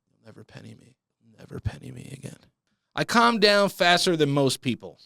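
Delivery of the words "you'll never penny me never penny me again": soft, low muttered threat; muttered vow. Acted. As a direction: growl it under the breath. Vowing revenge under the breath.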